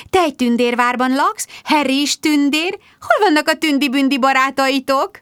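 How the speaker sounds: high, sing-song fairy talk; sugary baby talk. A narrator's reading. Rebecca cooing to the child about fairies. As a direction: coo it like a nursery teacher. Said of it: "trillázta taktikát változtatva Rebecca".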